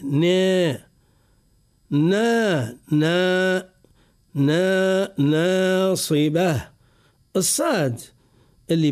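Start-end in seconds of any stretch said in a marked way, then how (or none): 0.0-6.7 ناصبة with a clean, light نا, drawn out slowly; correct light nun. Shown as a demonstration. Teacher drilling the correct nun in ناصبة. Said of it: "فنقول ناصبة".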